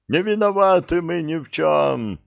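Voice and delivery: high wailing plea. Acted. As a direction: wail it out, pitched high. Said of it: "заголосила троица".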